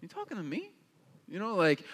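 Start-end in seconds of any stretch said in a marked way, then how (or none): 0.0-0.7 hushed, higher mock disbelief; a skeptical tough-guy challenge, half under the breath; incredulous. Pastor imagining the angel's greeting, incredulous hushed. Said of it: "I first would be like".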